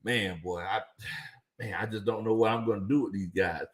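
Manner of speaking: low, soft, weary inner voice with a sigh; coach thinking aloud. Retold or imitated. Coach wondering aloud what to do with his players, low and soft. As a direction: mull it over like a stumped coach talking to himself. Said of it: "situation thinking".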